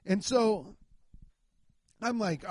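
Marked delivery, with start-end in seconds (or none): none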